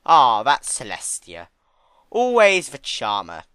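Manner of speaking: loud, slow, dry sarcastic drawl; dry sarcasm. Acted. Discord mocking Celestia's charm to Fluttershy. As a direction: sneer the compliment slowly. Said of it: "observed dryly".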